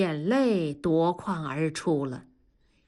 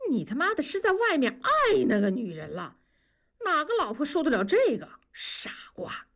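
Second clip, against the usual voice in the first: scolding; 7 semitones higher than usual. Acted. Friend scolding him.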